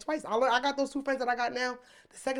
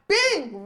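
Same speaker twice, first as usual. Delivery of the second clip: punch sound effect; 9 semitones higher, 7 dB louder than usual. Retold or imitated.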